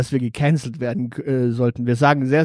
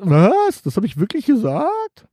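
surprised; 6 semitones higher than usual. Pretend. Acting shocked co-host.

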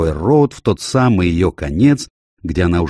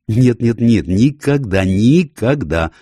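higher, slow, drawn-out "never"; emphatic, distressed protest; vehement refusal; 5 semitones higher, 4 dB louder, slower than usual. Acted. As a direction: refuse with your whole heart. Owner refuses to give dog away.

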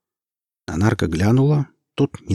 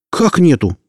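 higher, louder startled question; surprised; 9 semitones higher, 7 dB louder than usual. A narrator's reading. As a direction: caught off guard, demanding. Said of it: "удивился Нарк".

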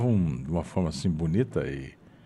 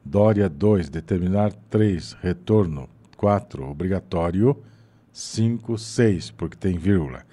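slow, each word followed by a counted number; counting between words; slower than usual. Shown as a demonstration. Teacher demonstrating the numbered pause exercise, slow counting. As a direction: tick off a number after every word. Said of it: "você coloca lá um número no meio".

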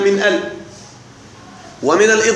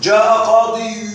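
slow example; slower than usual. Shown as a demonstration. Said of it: "كما نقول".

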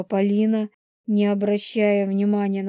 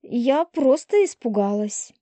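higher, pouty self-excuse; pouting; 5 semitones higher than usual. A narrator's reading. Полина excusing herself to Елисей, pouting. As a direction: say it with a pout, pitched up. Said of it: "надула губы Полина".

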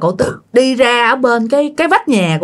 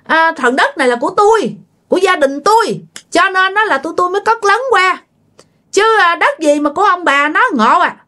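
higher-pitched indignant retort, acted; arguing back; 9 semitones higher than usual. Neighbour answers back over the land boundary, arguing. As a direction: play a neighbour disputing whose land it is. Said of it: "Thì bên kia mới cự".

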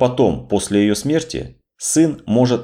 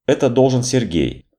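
ordinary delivery

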